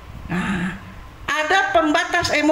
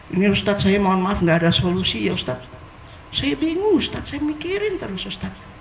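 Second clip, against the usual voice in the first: a hint of a low, helpless plea; lightly voiced worried patient; 7 semitones lower, faster than usual. Patient apologizing to the ustadz, lightly voiced helpless plea. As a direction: sound lost and apologetic, lower. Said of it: "Misalnya contoh".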